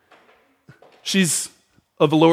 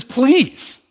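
higher, lightly voiced pleading mother; 7 semitones higher, slower than usual. Mother begging Jesus to heal her daughter, lightly pleading. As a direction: beg for the daughter, a touch lifted.

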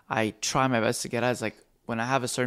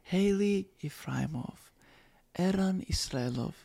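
mom musing; 6 semitones higher, 6 dB quieter, slower than usual. Retold or imitated. Mom trying on new surname.

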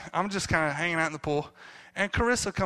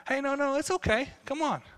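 mild gentle shooing; 7 semitones higher than usual. Retold or imitated. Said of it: "he wasn't just upset or".

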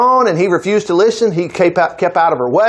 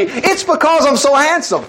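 smug Joseph; 7 semitones higher than usual. Retold or imitated. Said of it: "how could Joseph ... not think".

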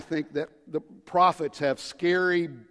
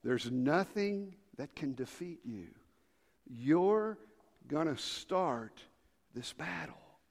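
ordinary talk, nothing marked

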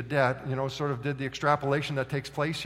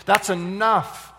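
slow, louder, decisive cut-off; fed-up king; 2 semitones higher, 6 dB louder, much slower than usual. Acted. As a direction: put your foot down as king. Fed-up king cuts him off.

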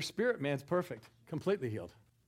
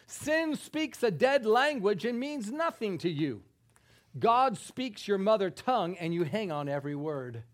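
loud, higher-pitched emphatic reading; preaching scripture reading; 6 semitones higher, 7 dB louder than usual. Acted. Preacher reading the Message Bible to the congregation, emphatic. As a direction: proclaim the verse with force. Reads Bible passage with force.

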